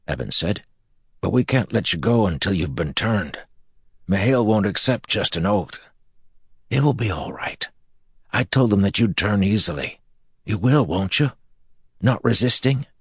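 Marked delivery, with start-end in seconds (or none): 1.2-12.9 put-on eager young man. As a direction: plead eagerly for agreement. Evan pleading eagerly.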